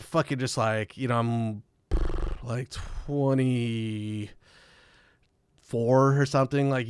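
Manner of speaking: ordinary talk, nothing marked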